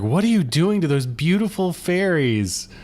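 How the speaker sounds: mildly hammed-up outraged question; mock freak-out. Host quoting the girl scolding Hoggle over fairies, mock freak-out. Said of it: "She's freaking out".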